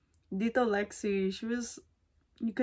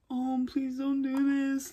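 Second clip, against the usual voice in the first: slow, higher mock plea; pleading dad; 5 semitones higher, slower than usual. Cal begging his son not to expose him, mock plea. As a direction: beg like a caught father, hammed-up.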